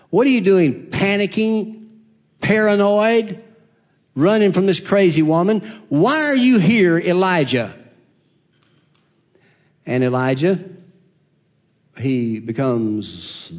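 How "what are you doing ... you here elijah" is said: raised challenging question. Acted. God questions panicking Elijah.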